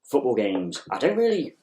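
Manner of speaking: normal talking voice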